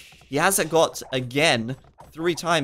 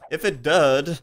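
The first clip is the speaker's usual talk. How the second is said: hammed-up Southern Texan twang on "did"; playful fake Texan accent; 2 semitones higher, 3 dB louder, slower than usual. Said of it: "Sound like a Southern Texan".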